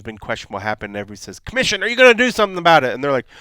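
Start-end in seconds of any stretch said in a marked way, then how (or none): 1.4-2.9 louder, higher, fast demanding shout; demanding fans. Retold or imitated. Fans demanding answers from the commission, a fast mock yell. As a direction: press them like an angry fan.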